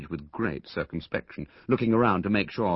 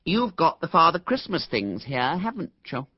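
higher, lightly mocking a snob's patronizing tone; boy imitating a snob, a touch higher; patronizing imitation; 10 semitones higher, 4 dB louder than usual. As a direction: put on the superior airs of a spoilt rich boy. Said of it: "a fairly good imitation of the Hubert Laynites' most patronizing manner".

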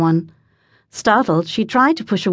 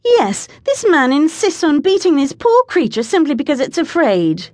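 indignant; 7 semitones higher than usual. A narrator's reading. Indignant complaint about the beating.

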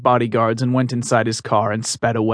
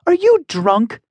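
higher, louder pleading defiance; a high, firm young heroine standing up; defiant heroine voice; 14 semitones higher, 4 dB louder, slower than usual. Acted. Emmy challenges Meyer.

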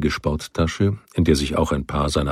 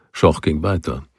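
ordinary delivery, nothing marked

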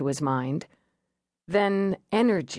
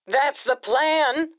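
slow, higher, flat-out resigned; resigned jumper; 8 semitones higher, 3 dB louder, much slower than usual. Acted. Jumper tells the negotiator his plan, slow and resigned. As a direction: drag it out, beaten down. Jumper answers about suicide.

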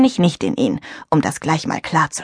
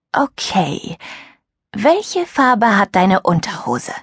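slow, deadpan cheeky question; a girl slowly and coolly teasing her rival; 3 semitones higher, slower than usual. Acted. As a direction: ask it without blinking. Julia asks cheeky question.

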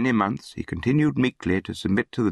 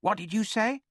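high, hushed challenge; affronted orphan boy; 4 dB quieter than usual. A narrator's reading. Orphan challenges the bully's insult to his mother, high quiet challenge. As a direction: question the insult in a high voice, held back in volume.

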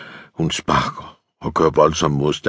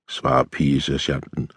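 ordinary delivery

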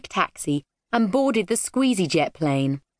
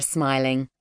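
usual speaking voice

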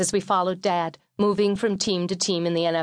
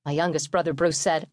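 plain reading voice

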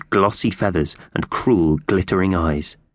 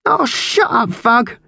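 very high, squawked rebuke; irritated magpie; 3 dB louder, slower than usual. Acted. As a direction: snap at him in a high bird voice. Slasher snaps at sobbing cellmate.

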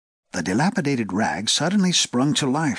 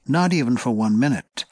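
usual speaking voice

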